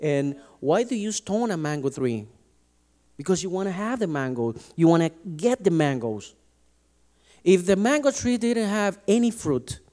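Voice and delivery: ordinary talk, nothing marked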